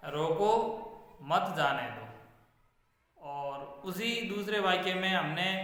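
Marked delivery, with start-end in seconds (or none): 0.1-2.1 "रोको" set apart, then "मत जाने दो" together; pause after रोको. Shown as a demonstration. Teacher reads the first sentence's meaning, pausing after "रोको". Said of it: "पहले वाक्य का अर्थ बनता है".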